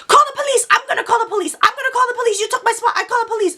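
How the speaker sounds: put-on frantic high-pitched threats in a Chinese accent; Chinese-accented yelling. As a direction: mimic a hysterical lady with a Chinese accent. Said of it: "very heavy Chinese accent".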